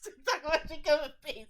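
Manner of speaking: giggling fast through the words; laughing. Real, not acted. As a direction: laugh while talking. Laughing at friend.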